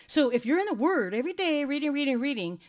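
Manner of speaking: emphatic preaching. Real, not acted. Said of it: "exhorting congregation loudly".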